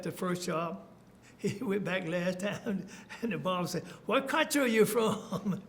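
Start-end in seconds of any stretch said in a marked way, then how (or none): 4.1-5.6 high, drawn-out question through laughter; amused mock question. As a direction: retell the barber's question, raised and laughing. Retells barber's question while laughing.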